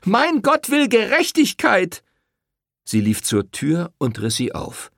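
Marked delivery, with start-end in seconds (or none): 0.0-2.0 high, slow, loud proclamation; loud defiant cry. Acted. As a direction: proclaim it as she leaves. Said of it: "rief Rosetta". (laut und feierlich ausgerufen)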